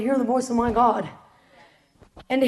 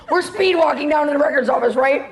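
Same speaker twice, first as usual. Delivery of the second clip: ordinary talk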